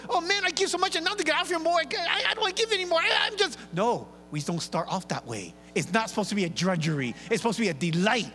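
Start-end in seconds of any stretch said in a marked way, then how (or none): 0.1-3.6 high, fast whiny complaint; grumbling giver. Retold or imitated. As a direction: whine about having to give more. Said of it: "And so".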